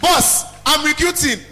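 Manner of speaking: ordinary talk, nothing marked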